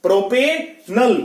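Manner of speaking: प्रोपेनल slowly, with a break before short 'nal'; careful pronunciation. Shown as a demonstration. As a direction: split प्रोपेनल as prope... nal. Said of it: "नाल नहीं कहना". (प्रोपेनल, धीरे से 'नल' अलग करके)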